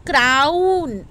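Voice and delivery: ordinary talk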